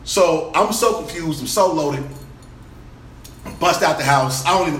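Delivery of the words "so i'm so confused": ordinary talk, nothing marked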